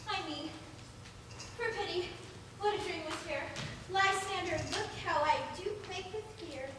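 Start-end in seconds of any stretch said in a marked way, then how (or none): none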